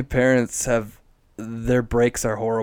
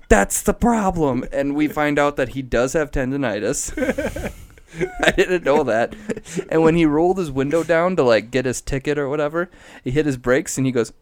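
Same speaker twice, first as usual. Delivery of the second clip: ordinary talk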